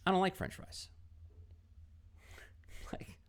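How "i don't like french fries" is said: flat refusal. Retold or imitated. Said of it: "And she's like".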